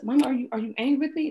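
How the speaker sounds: put-on small child checking if mom is mad; imitating her daughter. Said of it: "she will ask us".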